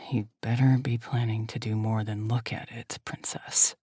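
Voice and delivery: low whispered mutter, teeth clenched; strained low growl of a whisper; muttered through gritted teeth. Acted. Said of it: "he mutters through gritted teeth".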